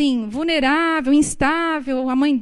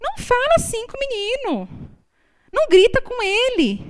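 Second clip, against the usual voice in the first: protective mother; 10 semitones higher than usual. Retold or imitated. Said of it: "se a mãe fala assim".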